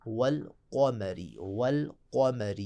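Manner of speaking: slow demo of कमरी with a heavy qaf. Shown as a demonstration. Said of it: "उस कॉफ को मोटा पढ़ेंगे".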